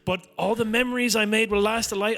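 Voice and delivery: high, sugary mock-upbeat farewell; mock cheerful post. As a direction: send up a phony cheerful LinkedIn goodbye. Mocking a fake-cheerful LinkedIn post.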